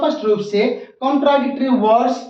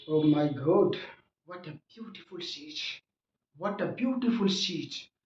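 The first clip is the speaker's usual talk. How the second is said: very soft, slow murmur of wonder; hushed involuntary exclamation; 5 semitones lower, 13 dB quieter, much slower than usual. Acted. Tourist blurting at a beautiful sight, hushed awe. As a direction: let it slip out under the breath, slowly. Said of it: "ऐसे निकल जाता है मुंह से".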